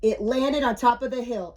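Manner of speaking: ordinary delivery, nothing marked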